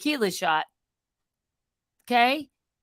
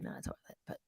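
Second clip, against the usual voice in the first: whisper; 22 dB quieter, faster than usual. Real, not acted. Whispered aside.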